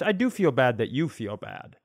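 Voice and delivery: usual speaking voice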